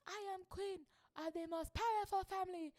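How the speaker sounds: high-pitched, hammed-up African-accented queen; fake African accent. Retelling the queen's speech, in a fake African accent. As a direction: put on a regal mock African accent. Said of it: "Excuse my fake African accent".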